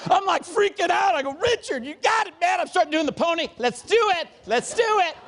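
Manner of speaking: high, worked-up excitement; hammed-up frantic enthusiasm; comic frenzy. Relives getting carried away dancing.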